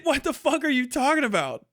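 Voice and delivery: usual speaking voice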